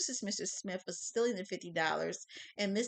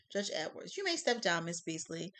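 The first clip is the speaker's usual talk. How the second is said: usual speaking voice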